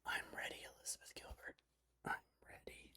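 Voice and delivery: hammed-up, breath-only whisper to oneself; barely audible mock-yearning whisper. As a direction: whisper it like a lovelorn bedtime plea. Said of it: "and whispering".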